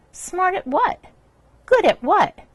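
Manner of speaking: asking pointedly. Shown as a demonstration. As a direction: challenge the word, voice lifted high. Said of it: "You have to ask".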